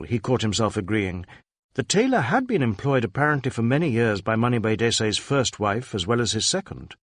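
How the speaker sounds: ordinary delivery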